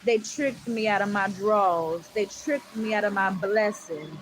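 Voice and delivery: slow, loud testifying, building up; rising sermon fervor; preaching. Real, not acted. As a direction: preach it to the congregation.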